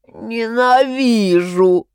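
sobbing. A narrator's reading. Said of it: "всхлипнул Илья".

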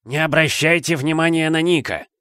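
high-pitched call to the crowd; shouting. A narrator's reading. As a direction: call out dismissively to everyone. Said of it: "прокричала голова сэра Патрика". (выкрик, высоким голосом)

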